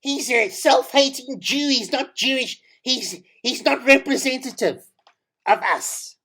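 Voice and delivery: mock the offended community member; lightly voiced disapproving listener; imagined critic. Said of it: "you're probably thinking".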